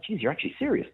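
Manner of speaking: high, incredulous jeez; can't believe she means it; disbelief. Retold or imitated. Disbelief at a silly question.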